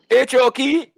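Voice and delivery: high, loud comedian's catchphrase; mock comedian. Explaining the reference, imitating the comedian's character, high and loud. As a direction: ham up the TV character's greeting. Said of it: "he was doing the character".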